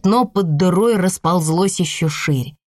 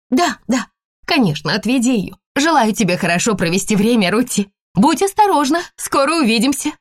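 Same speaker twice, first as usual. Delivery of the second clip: higher hurried mother voice; hasty, distracted goodbye; flustered mother; 6 semitones higher than usual. A narrator's reading. Mother nauseous, rushing. (торопливая растерянная мать)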